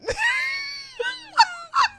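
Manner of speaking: shrieking high laugh; real shriek of laughter; high squealing laugh. Real, not acted. Laughing at clip.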